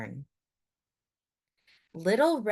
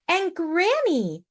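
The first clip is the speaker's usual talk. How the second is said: Little Red; 11 semitones higher, 4 dB louder than usual. A narrator's reading. Little Red voiced in story.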